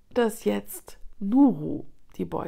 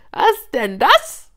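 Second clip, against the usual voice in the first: loud, high, drawn-out question; mocking hyena; 6 semitones higher, 9 dB louder, slower than usual. A narrator's reading. Hyena sizing up the cornered cub, mocking loudly. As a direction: stretch it out with loud mock curiosity.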